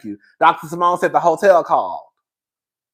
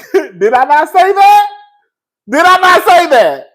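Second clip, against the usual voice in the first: excited; 10 semitones higher, 10 dB louder than usual. Real, not acted. Reminding viewers she predicted it.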